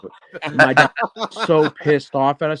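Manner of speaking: laughing while telling it. Real, not acted. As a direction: chuckle through the words. Laughs through the bowling story.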